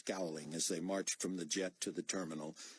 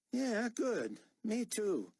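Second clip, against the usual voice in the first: put-on higher, louder sneering young soldier; mocking young corporal; 4 dB louder, much slower than usual. Corporal sneering at Buck's refuelling order, higher and mocking. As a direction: sass back at an officer you doubt.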